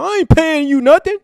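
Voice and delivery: high mocking voice of a cheapskate; mocking a cheap challenger. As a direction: sneer it like a broke debater.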